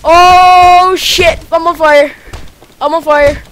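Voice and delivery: loud, high panicked cries; panicked yelling. Real, not acted. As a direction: yell in alarm at catching fire. Panics, set on fire in game.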